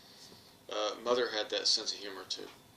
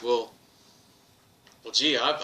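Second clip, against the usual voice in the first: mock confession; 8 dB louder than usual. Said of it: "my comeback was".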